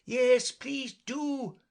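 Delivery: high, loud, drawn-out plea; chorus calling out. A narrator's reading. Birds joining Alice's call, loud high entreaty. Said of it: "the others all joined in chorus".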